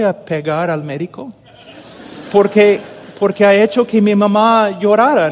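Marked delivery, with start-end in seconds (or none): 2.3-5.3 louder and higher, heated indignation; rising sermon anger over mother's tears; indignant outburst. Real, not acted. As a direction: burst out as if ready to hit him. Angry at doctor making mother cry.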